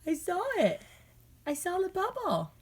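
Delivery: playful high parent praise. Real, not acted. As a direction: pitch it way up and drop at the end. Parent cooing over toddler's bubble.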